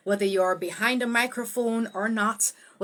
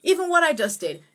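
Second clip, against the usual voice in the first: ordinary talk, nothing marked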